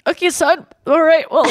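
a hint of an awkward, moved dad; lightly voiced dad. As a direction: play the dad caught off guard, lightly. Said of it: "the dad's like".

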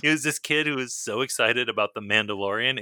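normal talking voice

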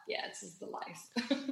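soft, slow, blissed-out sigh; lazy holiday bliss, barely voiced; relaxed contentment.

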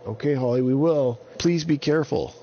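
lightly voiced Avon. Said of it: "And Avon said".

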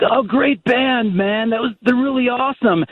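dopey stoner dude. Retold or imitated. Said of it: "stoner guy come up to me and be like".

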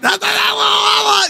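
high, squeaky la-la babble; silly high-pitched gabbing; mock phone chatter. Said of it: "talking like this on their phone".

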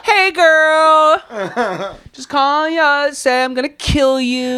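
high, cheery put-on greeting; sing-song friendly threat; mock creepy caller. Mock caller greeting a friend in a high, sing-song voice.

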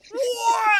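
high, loud shouted "What?"; shocked what. Pretend. Mocking the fake ending, loud shocked shout. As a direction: yell it in mock shock.

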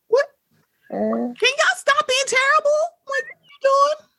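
very high exasperated plea. Retold or imitated. To terrible celebrities, very high exasperated plea. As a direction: beg them to stop, fed up. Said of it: "you just go".

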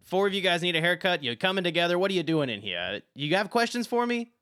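high, loud, put-on woman's voice; brassy barber lady. Barber Valette loudly greets the four customers. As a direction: boom it out like a bossy barber. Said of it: "she says".

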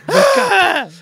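loud, high wake-up call; husband rousing his wife, loud and urgent; shout to wake. Acted. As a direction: yell her awake.

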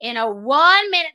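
condescending. Retold or imitated. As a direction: talk down to them. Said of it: "so condescending".

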